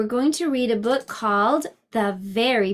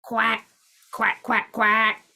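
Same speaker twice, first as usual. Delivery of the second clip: short quacks at usual pitch; duck's quack; slower than usual. A narrator's reading. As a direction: quack like a storybook duck. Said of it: "called the duck".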